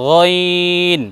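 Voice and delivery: গইন drawn from the throat; Arabic letter. Shown as a demonstration. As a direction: stretch গইন. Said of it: "এটা হয়ে যাবে". (গইন টেনে উচ্চারণ)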